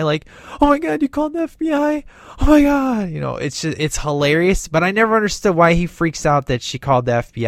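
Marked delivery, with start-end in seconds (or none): 0.6-3.1 squeaky panic, much higher and fast; really high freak-out. Retold or imitated. Teen hears his mom called the FBI, squeaking high in panic. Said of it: "when he talks really high".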